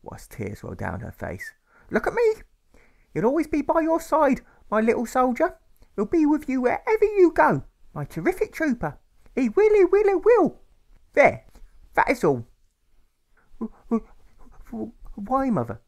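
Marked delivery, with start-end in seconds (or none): 1.9-12.5 very high, loud, sing-song mother insisting; a grieving mother clinging to hope; tearful mother. A narrator's reading. Mother reassures her son about his father, high and loud. 13.6-15.8 put-on higher child's voice, stammering; a scared little boy stumbling over words; stuttering boy. A narrator's reading. Alfred stammers a question to his mother. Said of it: "Alfred begins to stutter".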